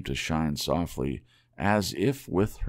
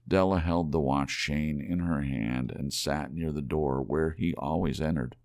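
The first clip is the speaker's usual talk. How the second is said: plain reading voice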